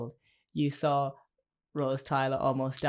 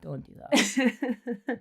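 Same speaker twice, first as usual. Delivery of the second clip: very slow, high, pleading; drawn-out protest; 7 semitones higher, much slower than usual. Pretend. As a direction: stretch it out in mock pain. Protesting friend's joke.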